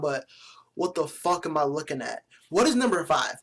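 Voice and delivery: ordinary talk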